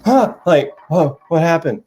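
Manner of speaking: jolted out of a doze; startled awake. Retold or imitated. Sleepy student jolted awake by the lecture, mock startled.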